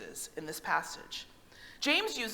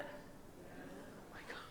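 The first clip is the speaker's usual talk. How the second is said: stunned whisper; 19 dB quieter, much slower than usual. Real, not acted. Shocked at time passing.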